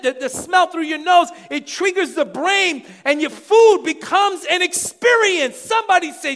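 loud, high, fervent preaching; preaching at full force. Acted. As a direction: drive it home to the congregation. Preaching about senses and experience.

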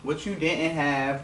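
ordinary delivery

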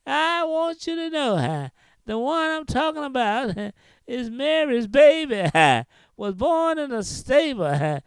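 rhythmic preaching with a huh after each phrase; whooping preaching. Acted. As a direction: chant it, breathing out a huh.

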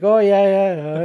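high oblivious agreeing voice; high dopey client voice. Retold or imitated. Retold client agreeing on hunt.

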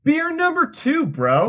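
very high, excited squeal; hyped-up announcement. Pretend. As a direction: hype the next beer in a silly high voice. Hyped echo announcing next beer.

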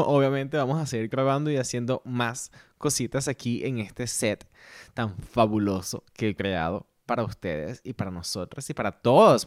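normal talking voice

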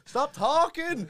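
slow, loud and high mock plea; loud stop. Mark begging himself to shut up, slow loud plea. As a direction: drag it out loudly. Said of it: "I'm saying".

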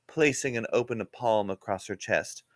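ordinary delivery

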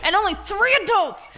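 high, loud, laughing desperation; hammed-up begging for the weed; frantic mock plea. Friend imitating her pleading.

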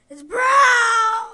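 loud, high horrified cry; dismayed shout. Real, not acted. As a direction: yell it in real dismay. Sees brown jelly bean.

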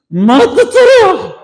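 high, loud mock yell; imitated father venting in a shout; frustrated father. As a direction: scream back at the baby. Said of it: "הבעלים מוציאים את התסכול על התינוק".

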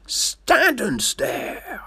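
slow, whispered Stand and stare; mocking whispered echo, Stand and stare. Shown as a demonstration. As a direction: breathe out Stand and stare in a hush. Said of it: "just stand and stare".

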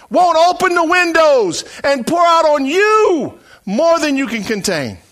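high, loud, stretched-out pulpit shout; thundering challenge with drawn-out key words; full-force preaching. Acted. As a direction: dare the whole room in God's voice.

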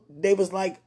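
normal talking voice

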